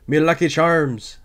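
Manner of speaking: mock Irish accent. Reviewer mocks Welles' Irish accent, slow hammed-up Irish. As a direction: draw out "Me Lucky Charms" in a cartoon Irish lilt. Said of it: "the Irish".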